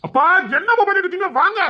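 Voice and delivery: high-pitched impatient summons; calling out. Acted. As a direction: yell for the parents to come. Summons parents from afar.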